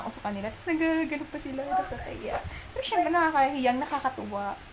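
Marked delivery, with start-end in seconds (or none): none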